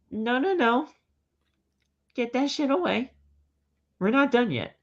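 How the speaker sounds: high mock Reigns refusal; lightly hammed wrestler refusing. Reigns voiced in wrestling recap.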